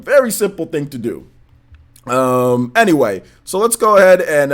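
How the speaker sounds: ordinary delivery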